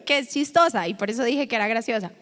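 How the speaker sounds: ordinary talk, nothing marked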